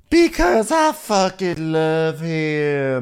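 slow, dramatic overwrought line; milk it like a soap climax; drama moment. Retold or imitated. Podcaster imitating Taylor's tearful climax, slow dramatic delivery. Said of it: "her full drama moment".

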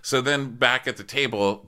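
normal talking voice

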